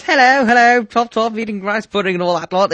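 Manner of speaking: high, loud, plummy posh English put-on; posh English caricature. Mocking a posh English speaker to the host, high and loud. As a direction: ham up a toff English accent for a laugh. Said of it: "made by someone who goes".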